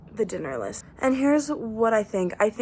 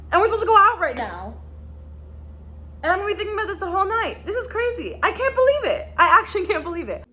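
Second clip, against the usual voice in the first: high, fast, stunned disbelief; can't believe what just happened; shocked excitement; 7 semitones higher, 3 dB louder than usual. Real, not acted. Shocked by book twist.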